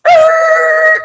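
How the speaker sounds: high raging yell of "Ah!"; furious shout. Retold or imitated. After the hit-and-run, a high furious yell. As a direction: let out a wild, furious scream. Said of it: "Now I'm stupid".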